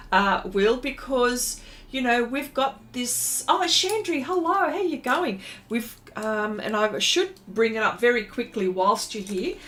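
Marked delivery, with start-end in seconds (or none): 3.5-5.4 cheery greeting. Real, not acted. Greeting viewer in chat.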